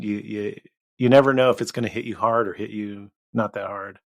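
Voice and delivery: usual speaking voice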